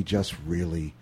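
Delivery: very soft, low, trailing away; barely audible mumble. Retold or imitated. Radio host mimicking Bill Murray's late style, barely audible. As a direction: imitate an actor who hardly speaks. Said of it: "where he speaks in, in audibly".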